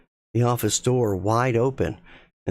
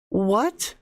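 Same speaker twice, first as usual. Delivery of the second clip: shocked; 10 semitones higher than usual. Acted. Rachel shocked.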